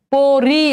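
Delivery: পরি split into long "po" and "ri"; syllable-by-syllable demonstration. Shown as a demonstration. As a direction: stretch both syllables of পরি. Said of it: "উচ্চারণ করো".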